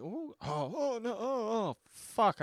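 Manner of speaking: high, loud yelps of mock dread; imitating herself recoiling at the thought of a rewatch, in high mock yelps; mock horrified yelps. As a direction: squeal as if the movie scares you all over again. Imitating her own reaction to rewatching.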